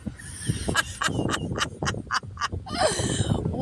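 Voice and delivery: long, high, breathy cackle; helpless laughter. Real, not acted. Storyteller cracking up over late-night DoorDash, helpless cackle. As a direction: let a high laughing fit run.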